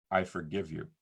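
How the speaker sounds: low, soft retelling of a victim's words; quiet forgiveness. Retold or imitated. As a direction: drop the voice for a hushed courtroom moment. Retold forgiveness in silent courtroom.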